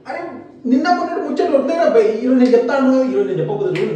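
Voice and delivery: insistent scolding. Acted. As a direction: lay down the law to a friend, loudly. Friend insists on being obeyed. (గట్టిగా దబాయిస్తూ)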